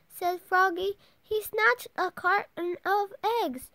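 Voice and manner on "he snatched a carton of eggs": plain reading voice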